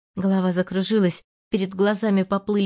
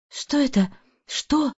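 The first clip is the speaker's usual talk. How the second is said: испуганное удивление; 7 semitones higher, slower than usual. Acted. Марианна видит мужа с любовницей.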